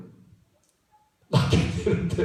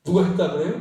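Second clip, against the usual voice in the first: usual speaking voice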